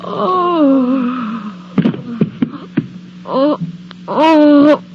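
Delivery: grief overwhelming her; building wails; sobbing moans. Acted. Sobbing over the body.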